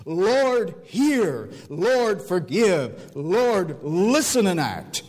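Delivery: high, drawn-out cries to God; impassioned prayer, sliding down from high notes; emotional pleading. Retold or imitated. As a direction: implore with each separate phrase swelling. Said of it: "look at the emotion he brings into it".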